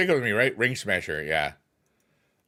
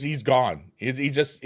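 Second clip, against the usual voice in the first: usual speaking voice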